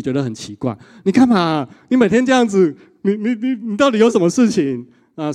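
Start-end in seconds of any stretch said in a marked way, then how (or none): 1.0-4.9 higher, louder exasperated mom; mock annoyed mother. Mother scolding her son for calling every day, exasperated. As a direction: nag like a puzzled, irritated mother. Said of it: "那妈妈就很生气".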